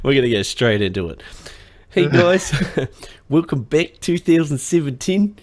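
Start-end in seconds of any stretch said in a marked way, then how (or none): 1.9-5.4 high, loud mock Kiwi accent; hammed-up New Zealand show opener; New Zealander host impression. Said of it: "I'm gonna do his intro".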